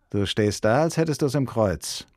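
usual speaking voice

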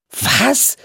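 high-pitched, surprised exclamation; astonished cry. A narrator's reading. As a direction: call it out in disbelief. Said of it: "rief Topper". (erstaunt ausgerufen)

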